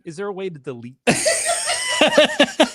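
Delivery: high, slow plea through laughter; sheepish laughing request; laughing embarrassed plea. Real, not acted. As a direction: beg to cut it while laughing.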